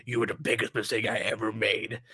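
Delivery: low, soft character line; voiced quote. Performing villain quote lines on stream.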